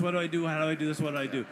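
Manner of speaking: ordinary delivery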